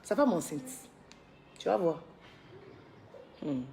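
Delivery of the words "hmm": normal talking voice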